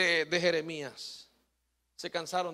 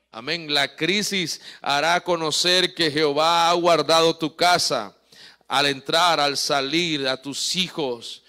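loud, driving pulpit preaching; sermon shouted with fervor; full-force preaching; 8 dB louder than usual. Acted. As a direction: preach it out hard to the congregation.

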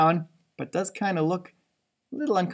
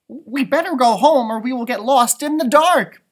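worried warning; 6 semitones higher, 6 dB louder than usual. Acted. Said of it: "warned Flitter".

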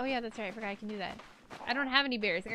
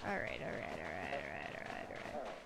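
low, fading goofy mumble; deep silly voice; 12 dB quieter than usual. Pretend. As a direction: drop way down and trail off. Drawn-out repeated all right.

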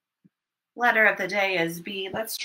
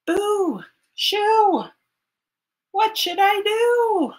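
high shout to shoo; 9 semitones higher, 4 dB louder, slower than usual. Acted. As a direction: shoo it away, loud and high. Shooing the jay off porch.

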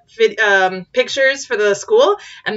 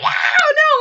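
very high mock shriek; hammed-up terrified scream; imitated screaming; 3 dB louder than usual. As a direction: squeal like panicking kids. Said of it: "It's just screaming".

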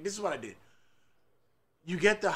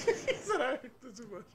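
laughing; 8 semitones higher, 8 dB quieter, much faster than usual. Real, not acted. Laughing retelling.

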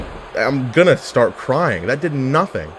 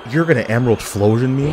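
ordinary talk

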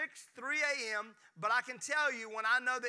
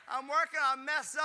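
high burst of laughter, louder; laughing; 4 semitones higher, 6 dB louder than usual. Real, not acted. Pastor laughing after teasing his wife Kristen.

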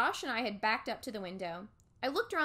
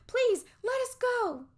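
high, loud frightened plea; panicked cry; 14 semitones higher, 7 dB louder than usual. Acted. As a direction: shield yourself and cry out. Begging the ghosts.

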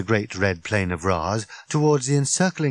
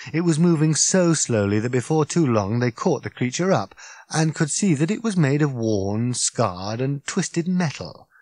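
ordinary delivery

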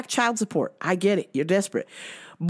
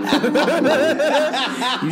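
louder laugh mid-sentence; loud laugh burst; 5 semitones higher, 5 dB louder than usual. Real, not acted. Mocking the ex-boss's cheating, loud laugh.

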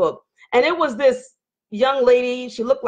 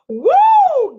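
a high, loud whoop of delight; excited whoop; 7 dB louder than usual. Real, not acted. Hyped host cheering at the chat, loud high whoop. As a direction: let out a real cheer, way up high. Hyped outburst on a live stream.

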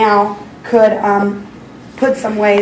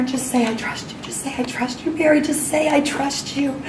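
softer, calm repeated prompting; gentle inner voice; 4 semitones higher, 9 dB quieter than usual. Retold or imitated. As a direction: quietly urge her to trust. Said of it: "he just put in there".

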